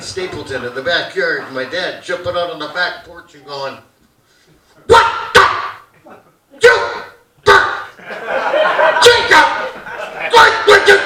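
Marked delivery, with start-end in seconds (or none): 4.9-11.0 high, loud sputtering dad; angry gibberish. Retold or imitated. Dad yells at sword-fighting sons, angry gibberish. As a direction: choke out broken bursts of mock rage. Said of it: "it just comes out as angry gibberish".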